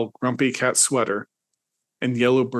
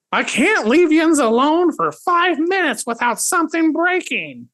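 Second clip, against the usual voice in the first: crazed mom yelling; 3 dB louder than usual. Acted. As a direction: shriek at the kids like a mad mother. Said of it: "stormed into the kitchen with a crazed".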